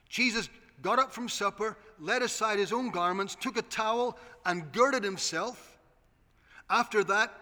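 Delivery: loud, driving scripture reading; full-force preaching. Real, not acted. Preacher to the congregation, booming scripture.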